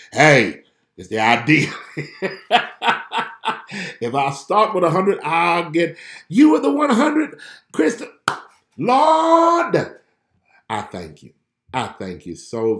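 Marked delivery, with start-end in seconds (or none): 0.1-9.8 much louder and higher, bursting with joy; excited shouting. Real, not acted. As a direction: keep riding the high, shouting thanks. Still celebrating the subscribers.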